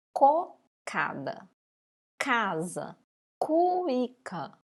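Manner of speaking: Cocada, casa, cuíca with a hard throaty k at each start; slow cocada, hitting the k hard from the throat; hard k sound. Shown as a demonstration. Teacher showing the class the hard k of cocada, casa, cuíca. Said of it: "k, parecendo que tá vindo ... garganta arranhando".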